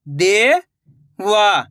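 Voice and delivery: देवा drawn out slowly, syllable by syllable; slow syllable split. Shown as a demonstration. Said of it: "मैं किस तरीके से".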